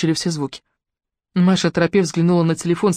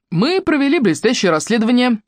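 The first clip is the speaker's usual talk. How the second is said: higher-pitched, proud announcement; mildly raised voice, declaring; loud announcement; 6 semitones higher than usual. A narrator's reading. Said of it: "громко произнёс он".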